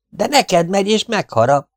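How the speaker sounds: high, urgent protest; alarmed warning. Acted. Partisan insists the scorpion will bite, alarmed. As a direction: insist in a high, frightened pitch.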